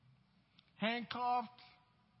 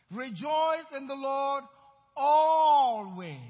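slow, loud preaching shout, louder on always; scripture yelled out; 2 semitones higher, 9 dB louder, much slower than usual. Acted. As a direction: yell it back at them, drawing it out. Repeated preached refrain.

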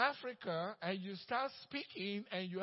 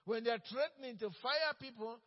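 normal talking voice

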